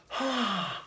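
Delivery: vocal train whistle imitation. Shown as a demonstration. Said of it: "For the train whistle, You gotta go".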